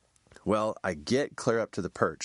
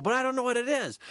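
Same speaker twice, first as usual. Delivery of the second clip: much higher, whiny; high helpless whine; helpless protest; faster than usual. Retold or imitated. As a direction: plead that you don't know. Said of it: "And I go".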